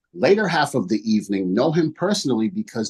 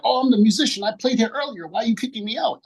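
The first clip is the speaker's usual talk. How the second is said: much higher, whiny put-on voice for the drunk musician; mock indignant musician; 11 semitones higher, faster than usual. Retelling the drunk musician's protest to the board, mock high whine. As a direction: ham up his outraged protest, pitched way up.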